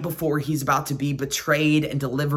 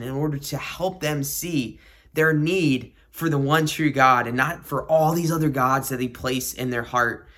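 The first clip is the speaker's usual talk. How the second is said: ordinary delivery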